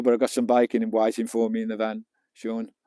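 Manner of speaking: normal talking voice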